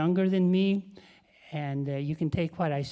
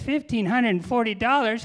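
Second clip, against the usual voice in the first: high, loud astonished question; mock outrage; 6 semitones higher, 6 dB louder than usual. Dog owner shocked by the vet's bill, mock outrage. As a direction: balk loudly at the price, acted.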